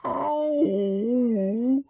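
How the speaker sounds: high squeaky yelp of pain. A narrator's reading. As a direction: yelp as the flannel scrubs. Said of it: "cried Piglet".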